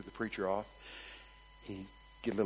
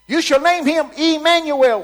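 loud high preaching; preaching at full force; 17 dB louder than usual. Acted. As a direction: proclaim the verse. Preached verse quote.

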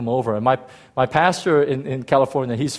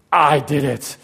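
mock boasting; 5 dB louder, slower than usual. Boasting flesh claims the credit.

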